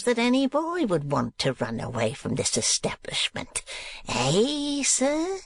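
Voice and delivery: mock the boy with cruel glee; sneering bully, pitch leaping up at the end; Squeers taunting. A narrator's reading. Squeers taunts before beating.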